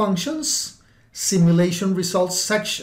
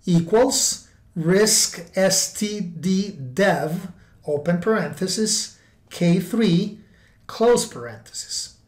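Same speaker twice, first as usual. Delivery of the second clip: dictating. Shown as a demonstration. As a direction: read it out so they can type it. Reading out a formula.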